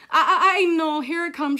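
loud, high whine; dramatic here-we-go-again dismay; exasperated dread. Acted. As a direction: groan inwardly like a cornered kid. Gabby dreads another lecture.

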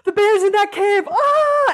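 high, loud startled cry of fear; hammed-up terror at a remembered bear; mock panic. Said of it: "which is different than".

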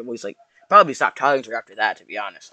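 normal talking voice

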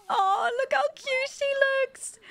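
very high, gushing squeal; high-pitched delighted cooing; adoring coo. Real, not acted. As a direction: gush over something cute. Cooing over Sim in bath.

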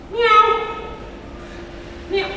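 high playful meow; cat meow. Pretend. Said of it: "cat sit ups".

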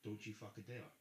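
low, soft, menacing warning; dead-serious threat, kept quiet. Real, not acted. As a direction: warn them off in a low voice. Threat after son is brought up.

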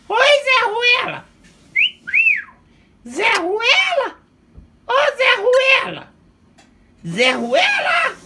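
very high, loud, slow parrot squawk; parrot voice shouting. Pretend. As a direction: screech the name like a parrot.

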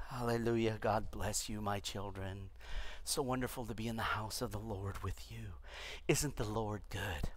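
hushed, doddering benediction; feeble old pope. Pretend. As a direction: mimic a frail pope blessing the crowd.

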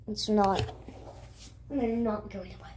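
interrupted by hiccups; hiccuping between words. Real, not acted. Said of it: "I have the hiccups".

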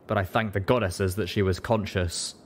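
ordinary delivery

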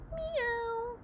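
high soft meow; cat meow. Acted. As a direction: mew like a small cat. Cat answers.

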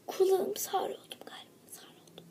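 hushed grumbling whisper. Acted. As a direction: whisper it as if deafened. Whispered complaint after scream. (fısıltıyla şikayet)

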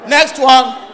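ordinary delivery

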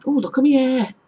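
broad Middlesbrough, H dropped, high and loud; Middlesbrough accent. Retold or imitated. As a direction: exclaim it in broad Middlesbrough dialect. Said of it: "So you'd hear people say".

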